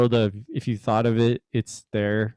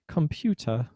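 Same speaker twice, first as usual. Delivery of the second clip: fake British accent; 4 semitones higher, 4 dB quieter than usual. Shown as a demonstration. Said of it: "and you'd be like".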